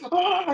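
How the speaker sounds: very high mock scream of pain; villain's scream. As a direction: let out a flailing, hammed-up shriek. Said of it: "and he's just like".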